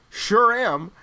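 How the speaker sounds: loud mock-Australian reply; hammed-up, awful Australian accent; bad Australian accent. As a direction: parody a fake Aussie tough guy. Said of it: "puts on the worst Australian accent ever".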